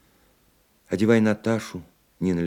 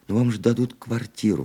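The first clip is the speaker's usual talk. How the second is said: exclaiming; 8 semitones higher, 3 dB louder than usual. A narrator's reading. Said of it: "воскликнула мать".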